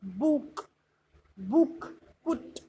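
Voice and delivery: बुक, बुक, पुट one at a time with short u; short U sound. Shown as a demonstration. Teacher showing the u sound, बुक, बुक, पुट with a short vowel. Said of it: "अब यू की sound देखिए".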